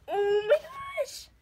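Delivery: high, drawn-out gasp of delight; excited squeal. Real, not acted. Opening her Kylie package, a real high excited squeal. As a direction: squeal it out as the box opens.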